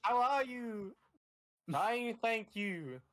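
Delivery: high, slow silly greeting voice; squeaky pretend voice, slowed way down; high put-on voice.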